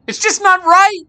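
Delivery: put-on high, loud distressed girl; crying out. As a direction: cry out in protest. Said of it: "cried Marie".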